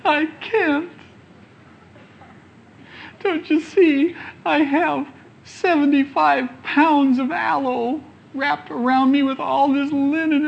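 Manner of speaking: high, pitiful whimper of a stuck dead man. Acted. Lazarus whimpering back at Jesus from the tomb. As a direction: whine it like a helpless mummy. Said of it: "In a whimpering voice".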